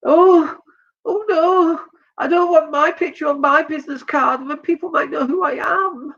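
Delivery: high, whining mock protest; mock whiny voice. Mocking someone who dislikes photos.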